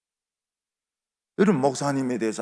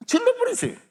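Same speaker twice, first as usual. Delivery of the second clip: sudden loud, very high exhortation; urging shout; 7 dB louder than usual. Real, not acted. Preacher urging congregation to shout out, urging shout. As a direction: burst out high and loud to urge them.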